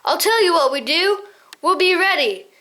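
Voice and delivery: bold cry. Acted. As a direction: call them to arms. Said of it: "he cried".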